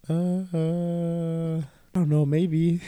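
long stalling "uh", pitched a little up; stall, then hedge with a maybe; retold hesitant hedging. Hesitant answer to mom while driving.

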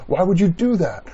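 usual speaking voice

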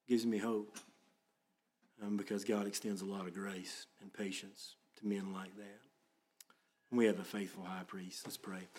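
usual speaking voice

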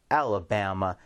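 ordinary talk

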